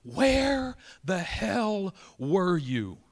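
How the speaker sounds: high, very slow drawn-out cry, held back in volume; restrained re-enactment of screaming at someone; retold anguished scream. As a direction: imitate a wail, stretching every word. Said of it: "I screamed at the chair".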